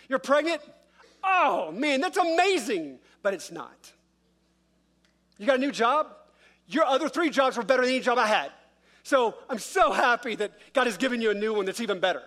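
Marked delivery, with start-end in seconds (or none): 0.1-3.0 higher and louder over-the-top gushing, slowing on amazing; fake enthusiasm. 5.4-12.2 higher, louder strained congratulations; forced fake cheer. Fake congratulations on new job.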